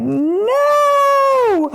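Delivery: very high, loud cry of fear; terrified scream. Retold or imitated. Cowering girl screaming as her dad comes in, terrified. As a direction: shriek it cowering under your arms. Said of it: "I'm like".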